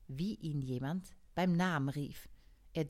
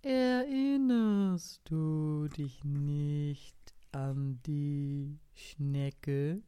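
slow snail; 3 dB louder, much slower than usual. Acted. Snail keeps dragging her words.

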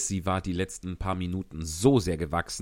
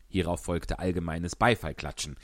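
ordinary talk, nothing marked

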